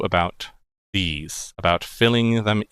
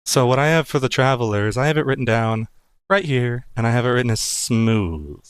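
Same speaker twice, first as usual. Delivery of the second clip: ordinary delivery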